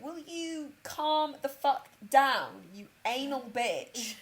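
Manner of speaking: slow, drawn-out exasperated plea; mock exasperation. Family telling mum to calm down, mock exasperated. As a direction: stretch each word in exasperation.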